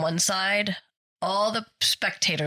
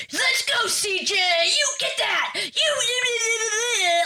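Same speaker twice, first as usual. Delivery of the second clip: parent cheering yell; much slower than usual. Retold or imitated. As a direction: shriek it like a parent in the stands. Parents screaming behind her.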